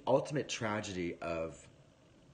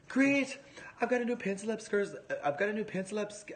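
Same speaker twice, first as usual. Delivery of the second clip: much higher, fast, gushing delight; thrilled mum; 13 semitones higher, 4 dB louder than usual. Retold or imitated. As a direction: squeal it like a mum overjoyed at a new project. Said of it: "And she says".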